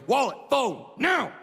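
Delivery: sharp mock shout; mugger's demand. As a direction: imitate a robber demanding valuables. Mugger barks orders at him.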